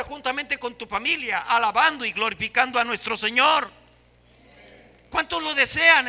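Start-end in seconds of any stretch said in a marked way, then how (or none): 5.1-6.1 loud raised call to the congregation; preaching call. Real, not acted. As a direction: rouse the room with the question.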